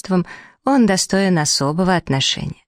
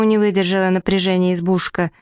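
usual speaking voice

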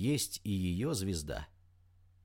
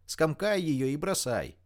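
higher, urgent call; shouted instruction; 5 semitones higher than usual. A narrator's reading. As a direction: call out orders to a friend. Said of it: "закричала Рита".

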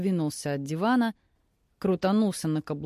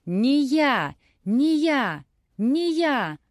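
frantic yell climbing higher and louder; frantic shouting hostage taker; 7 semitones higher, 4 dB louder, much slower than usual. A narrator's reading. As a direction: scream the denial, each louder. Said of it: "заорал похититель".

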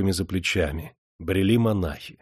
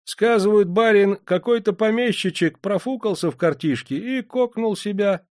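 offhand, higher peasant gossip; indifferent peasant; 12 semitones higher than usual. Acted. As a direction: shrug it off like a bored gravedigger. Peasant's voice held.

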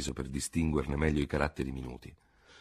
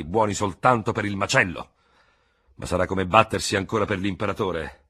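bitter veteran; 5 semitones higher, 6 dB louder than usual. Acted. Bitter veteran retorts loudly.

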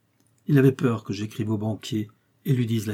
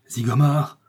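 nom lancé en exclamation, finale montante; s'écrier en reconnaissant l'ennemi; 3 semitones higher, 4 dB louder, slower than usual. A narrator's reading. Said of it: "s'écria Paulin Broquet". (cri de stupeur)

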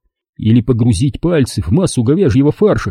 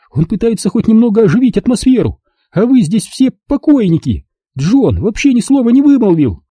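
angry defence; 11 semitones higher than usual. Acted. Lauren defends Kurt angrily.

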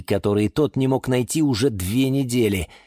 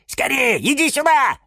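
high, eager calling; squeaky-high impatient welcome; excited urging; 4 dB louder than usual. Acted. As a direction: hurry him in excitedly. Plants hurry him inside.

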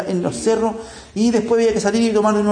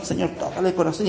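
nervously, much softer; a worried murmur, much softer and lower; nervous, quiet; 5 semitones lower, 7 dB quieter, faster than usual. Retold or imitated. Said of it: "Y él muy nervioso dijo".

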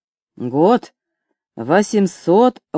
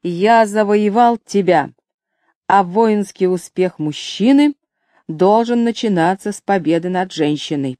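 ordinary delivery, nothing marked

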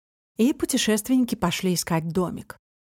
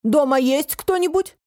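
higher, pointedly audible call; deliberately loud voice; 6 semitones higher, 3 dB louder than usual. A narrator's reading. Фантик checks if anyone is home, pointedly loud. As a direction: call out for the hidden one to hear. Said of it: "специальным громким голосом сказал".

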